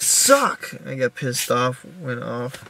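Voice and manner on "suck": sharp high yelp of frustration; angry outburst. Retold or imitated. Pin gone again, a sharp high frustrated cry. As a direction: spit it out, fed up. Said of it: "I was like".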